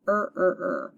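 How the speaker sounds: er, er, er, stretched r vowel each time; er sound repeated. Shown as a demonstration. Teacher leading students in saying er. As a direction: model er for the class. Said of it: "Everybody say er".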